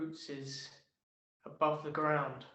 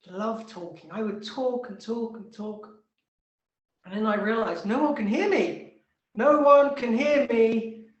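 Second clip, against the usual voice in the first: louder, higher grumbling tree voice; indignant tree ranting; 5 semitones higher, 13 dB louder than usual. Acted. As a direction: rant like a fed-up tree. Tree complains to Krishna.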